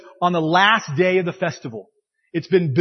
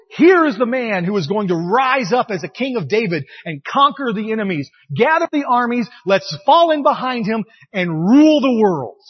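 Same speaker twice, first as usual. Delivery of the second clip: loud, higher rallying cry; rousing proclamation; 3 semitones higher, 5 dB louder than usual. Acted. Crowd hailing the Messiah in the temple courts, rousing proclamation.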